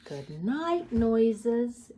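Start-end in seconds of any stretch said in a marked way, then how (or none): none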